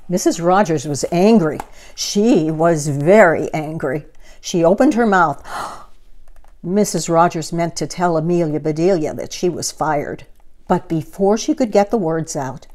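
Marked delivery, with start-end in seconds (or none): 5.5-6.4 sharp gasp. Acted. She opened her mouth.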